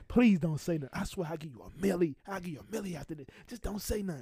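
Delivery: soft, mock-desperate begging; retold hushed pleading. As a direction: beg under your breath, imitating a desperate bribe. Acting out hushed plea, police arriving.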